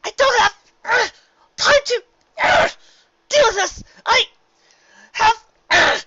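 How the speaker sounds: high, loud, crying shout with grunts; screaming while heaving against the sand; desperate cry for help, loud and high; straining scream. A narrator's reading. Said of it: "She screamed".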